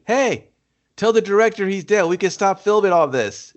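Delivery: mock the actor breaking character, high; a lightly hammed-up extra calling to the crew. Actor voiced in mockery.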